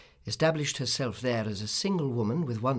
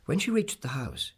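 ordinary delivery

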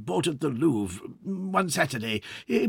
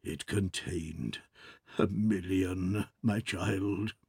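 softer and slower, hushed; lower voice; 3 semitones lower, 5 dB quieter, much slower than usual. Acted. Father telling his daughter the loss, hushed and slow. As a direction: confide it in a low hush. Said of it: "in a still lower voice he said".

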